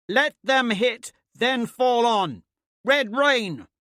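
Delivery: slow, high, loud battle command; shouted orders. A narrator's reading. Commander shouting orders up to Thraxa on the roof. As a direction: call the tactic up to the rooftop.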